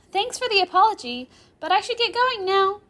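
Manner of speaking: high, loud, breezy goodbye; Rimuru's girl voice. Acted. Girl thanking the professor as she leaves, high and loud. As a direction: pitch it well up for the girl.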